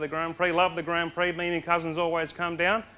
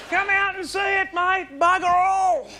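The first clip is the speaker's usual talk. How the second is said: high-pitched shouted invitation, loudest at the end; mock promotional holler; 12 semitones higher, 4 dB louder than usual. Comedian selling tourists an empty outback, mock promotional holler. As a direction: holler it like an eager sales pitch.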